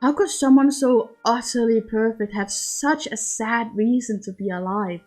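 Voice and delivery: plain reading voice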